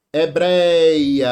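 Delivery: "Hebreia" loud, with a long drawn-out stressed "brei"; syllables stretched. Shown as a demonstration. Teacher showing a paroxítona, "Hebreia" stretched on its stressed syllable. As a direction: lean hard and long on the middle of "Hebreia". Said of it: "como é que separa".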